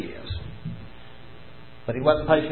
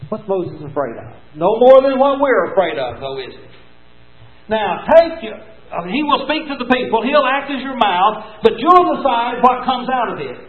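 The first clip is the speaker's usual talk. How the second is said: ordinary talk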